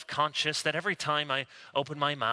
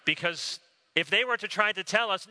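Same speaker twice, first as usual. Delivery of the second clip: usual speaking voice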